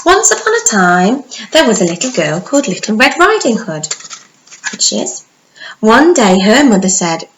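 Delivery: bright, lively storybook narration; animated storytelling voice; reading to children. Acted. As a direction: tell it like bedtime story for little ones.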